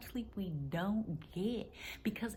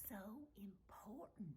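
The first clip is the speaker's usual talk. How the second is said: "so important" repeated in an even softer whisper; fainter whisper; 17 dB quieter than usual. Real, not acted. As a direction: let "so important" fade almost to breath. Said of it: "I'm whispering".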